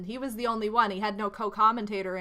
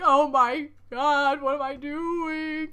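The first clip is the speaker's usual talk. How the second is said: mock panic; 4 semitones higher, 4 dB louder, slower than usual. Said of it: "I was like".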